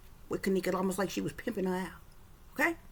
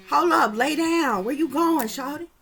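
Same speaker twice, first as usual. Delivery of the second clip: slow, loud, higher man's voice; pushy man voice; 4 semitones higher, 6 dB louder, slower than usual. Retold or imitated. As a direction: push him around loudly. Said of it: "You hear the dude say".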